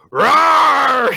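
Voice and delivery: loud, high mock battle roar. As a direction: roar like a whole gang charging. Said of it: "they all go".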